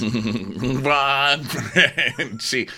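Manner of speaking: slow ghost moan through laughter; laughing ghost voice. Pretend. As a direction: wail it while cracking up. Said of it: "The ghost of Christmas always".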